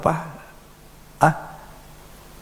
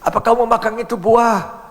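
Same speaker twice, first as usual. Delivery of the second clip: questioning God; 8 semitones higher than usual. Acted. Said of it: "Akui salahmu".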